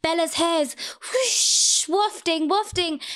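slow, airy and high; dreamy mock drama. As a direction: draw it out like slow motion. Mocking slow-motion movie scene.

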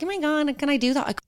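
high-pitched worried questioning; squeaky mock hesitation; retold self-doubt. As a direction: act out wondering aloud, well up in pitch. Retold doubt about the request.

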